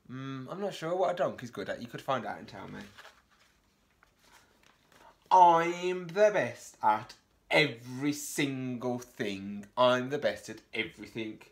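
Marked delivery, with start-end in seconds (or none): 5.3-11.4 loud, slow show-off brag; boastful dog. Acted. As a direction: brag it out to the whole farm. Dog boasting in read story.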